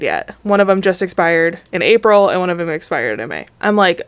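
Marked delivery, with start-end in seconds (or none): none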